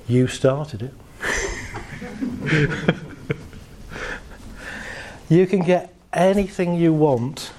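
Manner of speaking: ordinary talk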